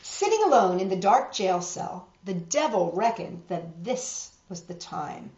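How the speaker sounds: usual speaking voice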